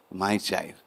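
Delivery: soft, low divine mother calling; lightly voiced loving goddess; gentle mother voice. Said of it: "a beautiful voice of a mother".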